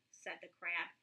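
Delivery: usual speaking voice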